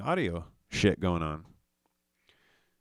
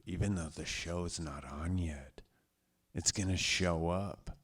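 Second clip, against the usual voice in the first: hushed aside to viewers; whispering aside; 5 semitones lower, 8 dB quieter than usual. Real, not acted. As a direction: drop to a whisper.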